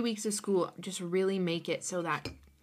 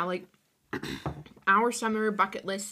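ordinary delivery

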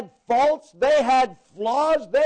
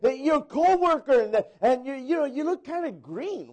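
normal talking voice

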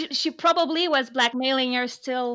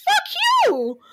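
much higher, louder mock yell of fuck you; mock outraged yell; 4 dB louder than usual. Telling off the transphobic character in a mock outraged yell. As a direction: hurl it at him in mock outrage.

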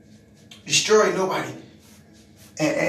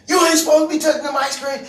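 fast, higher, louder scolding; mock angry shout; 6 semitones higher, 4 dB louder, faster than usual. Coworker shouting at him over the ice creams, angry and fast.

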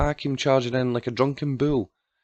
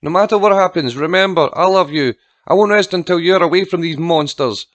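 high, loud, urgent vow; desperate shout; 6 semitones higher, 7 dB louder than usual. A narrator's reading. Aunt promises child rescue while removed, desperate shout. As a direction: call out fervently, voice raised throughout.

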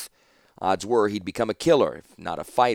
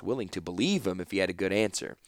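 ordinary delivery, nothing marked

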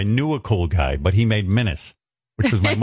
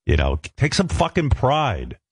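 normal talking voice

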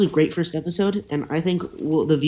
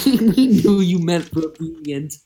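slow, laughing "revenient"; laughing tease; 3 dB louder, slower than usual. Real, not acted. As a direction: tease him through a laugh. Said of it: "you knew what you were doing".

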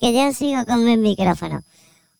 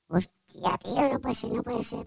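panicked whisper; 10 dB quieter, slower than usual. Acted. Hears mother coming, panics.

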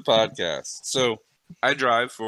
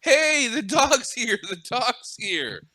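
high, slow, crying-out yell; calling out happily to his buddies; excited yell; 9 semitones higher, slower than usual. Retold or imitated. Dog owner yelling to buddies the dog is back, high excited yell. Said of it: "I can hear the guy yell to his buddies".

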